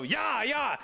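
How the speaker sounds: high, eager mock urging; imitating a rider geeing up a horse. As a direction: egg the horse on, playfully.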